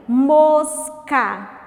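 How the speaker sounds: MOSCA split loudly as "mos... ca"; syllable by syllable. Shown as a demonstration. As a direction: punch out each syllable of Mosca. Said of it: "vamos separar as sílabas". (Mosca dito sílaba por sílaba)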